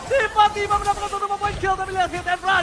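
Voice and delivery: excited. Real, not acted. Caster excited at sudden kill.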